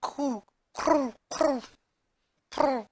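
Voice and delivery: high, soft trilled coo; dove cooing. Shown as a demonstration. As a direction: imitate a dove's gentle high call. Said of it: "Coo is the sound that doves make".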